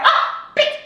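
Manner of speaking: high, breathy stunned exclamation; shocked gasp. Retold or imitated. Reliving the awkward skipped high five, shocked. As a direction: let out a high startled gasp of disbelief.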